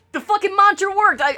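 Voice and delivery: ordinary talk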